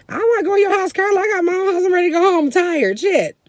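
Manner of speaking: high-pitched mock complaint; exasperated refusal. Mom telling her sister she's going home, exasperated high voice. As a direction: protest wearily but loudly high, imitating mom.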